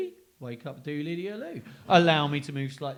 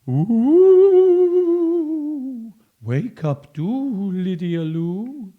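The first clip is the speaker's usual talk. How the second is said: high, slow, loud ghostly wail; spooky ghost; 8 semitones higher, 14 dB louder, much slower than usual. Acted. Ghost trying to wake the sleeping girl, ghostly wail. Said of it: "The ghost said".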